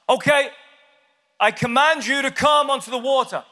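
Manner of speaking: high, projected voice of command; commanding Lord. Acted. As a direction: play Jesus issuing a grand order. Jesus acted commanding Peter.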